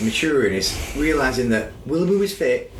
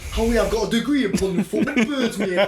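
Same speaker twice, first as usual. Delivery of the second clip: higher-pitched Geordie impression of Anthony McPartlin; Geordie accent; 4 semitones higher than usual. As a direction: play up a comic Geordie accent. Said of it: "dangerously good at the Geordie accent".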